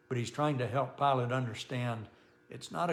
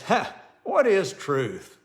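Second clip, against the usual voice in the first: loud, slow scoffing "Ha, what is truth?"; 6 semitones higher, 8 dB louder, slower than usual. Shown as a demonstration. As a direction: sneer it out with a mocking laugh. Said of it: "See the difference?".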